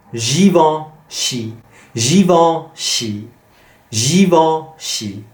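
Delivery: Givenchy drawn out slowly, nasal vowel in the middle; slow repeated Givenchy drill; slow French drill. Shown as a demonstration. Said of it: "So this is en".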